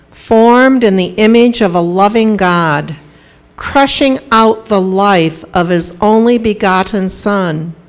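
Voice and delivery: usual speaking voice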